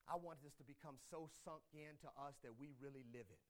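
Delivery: usual speaking voice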